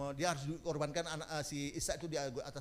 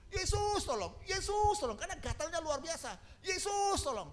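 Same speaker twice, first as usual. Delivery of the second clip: much higher, louder desperate cry; the itching patient calls out to Jesus; very high anguished plea; 13 semitones higher, 4 dB louder than usual. Retold or imitated. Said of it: "dia tahu ikuti".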